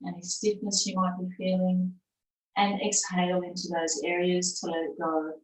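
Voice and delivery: unhurried, settled meditation cueing; guided meditation. Acted. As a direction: guide them gently into stillness. Leads a breathing meditation.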